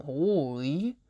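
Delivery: ordinary talk, nothing marked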